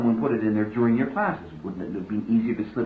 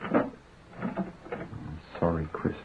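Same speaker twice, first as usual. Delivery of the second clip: whispered apology; 4 semitones lower, 7 dB quieter, much slower than usual. Acted. Husband apologising to shaken wife.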